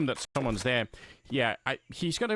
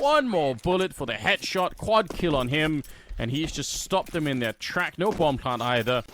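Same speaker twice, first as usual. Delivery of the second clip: slow, loud hyped kill call; esports caster; 3 semitones higher, 4 dB louder, slower than usual. Acted. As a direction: stretch out the quad kill call. Caster calls a quad kill.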